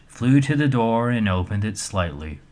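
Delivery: plain reading voice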